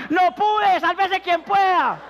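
high, loud, slow panicked cry; panicked shout. Acted. Bomb-squad man bolting in panic, loud high cry. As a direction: yell it running for your life.

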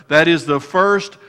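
full-force sermon delivery; fervent preaching. Acted. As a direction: preach it out to the congregation.

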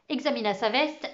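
ordinary delivery, nothing marked

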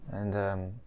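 muffled behind cloth mask; speaking under fabric covering; through a face mask. Real, not acted. Seen 1: Cloth mask covers mouth and nose throughout; absent in references.

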